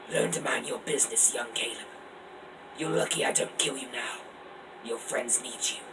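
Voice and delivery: soft, breathy threat; menacing oracle whisper. Acted. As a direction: warn him in an eerie hush.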